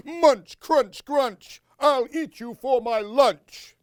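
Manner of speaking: a mock monster chomping for a child; playful monster. Josie playing monster.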